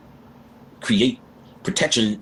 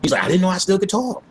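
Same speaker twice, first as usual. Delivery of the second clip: raised, amazed retelling of his words; retold surprise; 9 semitones higher than usual. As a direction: echo his amazed relief, pitched up. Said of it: "looked at Linda and said".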